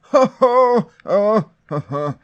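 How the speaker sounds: high, loud fussy exclamations; C-3PO. Retold or imitated. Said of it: "He's all C three P O over here".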